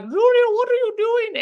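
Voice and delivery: high, loud put-on older woman's voice; a rough comic take on a famous tennis champion; Martina impression. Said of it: "That's my Martina impression".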